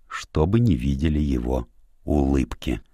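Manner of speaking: plain reading voice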